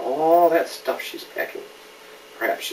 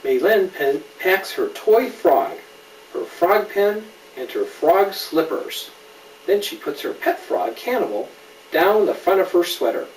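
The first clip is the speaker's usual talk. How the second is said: clear, lively storybook reading; gentle picture-book narration; reading to a child; 3 semitones higher, 4 dB louder than usual. A narrator's reading. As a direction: read it to a little one, naming each friend. Reads picture book aloud.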